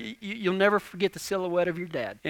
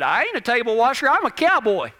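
put-on fast, louder child's declaration; a little boy insisting he's a cowboy; kid's proud retort; 7 semitones higher, 7 dB louder than usual. Little son refusing chores, proud cowboy retort. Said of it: "looked at her and said".

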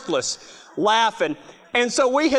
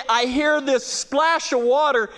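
normal talking voice

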